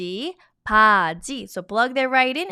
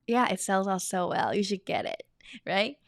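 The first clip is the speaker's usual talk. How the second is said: normal talking voice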